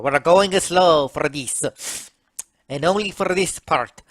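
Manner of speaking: ordinary delivery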